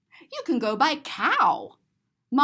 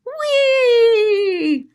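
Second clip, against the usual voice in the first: loud high squeal of joy; gleeful whee; 10 semitones higher, 10 dB louder than usual. Acted. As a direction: shout like on a ride. Riding the fish.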